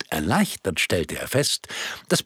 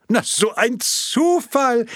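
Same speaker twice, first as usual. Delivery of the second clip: mock surprise; 3 dB louder, slower than usual.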